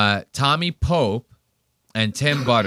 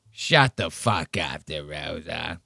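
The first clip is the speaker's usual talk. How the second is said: slow, lowered imitation of a comic; mock put-down; 6 semitones lower, 4 dB quieter, slower than usual. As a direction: drag out the put-down, dropping low at the end. Imitates Tony's retort from clip.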